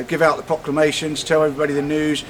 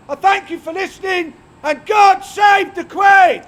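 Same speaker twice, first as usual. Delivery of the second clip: loud high proclamation voice; town crier; 14 semitones higher, 5 dB louder than usual. Acted.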